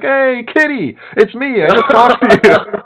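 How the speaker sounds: imitating his own giddy excitement; excited greeting. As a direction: burst in like you've found an old friend.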